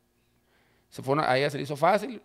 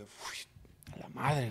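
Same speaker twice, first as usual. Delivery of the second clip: whispered exhale of relief; re-enacted speechless relief; stunned whisper; 3 semitones lower, 11 dB quieter, much slower than usual. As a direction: breathe it out, barely voiced. Retold stunned relief at the news.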